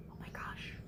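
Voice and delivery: soft unvoiced gasp of shock; whispered aside. Real, not acted. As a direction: breathe it in disbelief. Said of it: "narrator's own whispered reaction".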